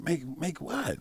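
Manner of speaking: high, confused "make what?"; retold stunned question; stunned disbelief. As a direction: grasp at the words in shock. Learning his mom died.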